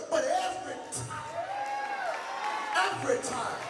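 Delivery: shouted every time; preaching shout. Acted. Pastor calling out the refrain, shouting. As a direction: hammer the refrain.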